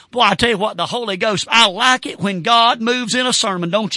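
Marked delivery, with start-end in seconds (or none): none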